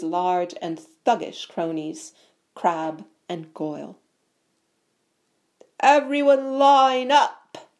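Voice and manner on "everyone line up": loud, high, drawn-out call; roar. A narrator's reading. Bully summons the crowd to line up, roaring. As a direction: bellow it slowly to a crowd. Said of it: "Malfoy roared to the crowd".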